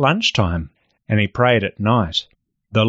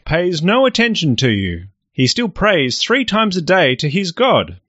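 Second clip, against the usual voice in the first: tattling courtiers; 9 semitones higher than usual. Acted. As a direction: tell on Daniel to the king. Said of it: "bowed and said".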